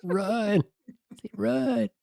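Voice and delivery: very high cartoon voice; squeaky panicked testicle. Voicing testicles, laughing.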